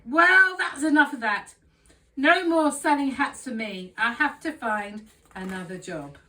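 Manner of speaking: high, loud giving-up declaration; loud exasperated outburst. Acted. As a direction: fling the hat and quit. Man gives up, hurls hat.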